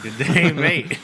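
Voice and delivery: jokey Australian greeting; Australian accent. Acted. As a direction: parrot it back in mock Australian. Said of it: "She had an Australian accent".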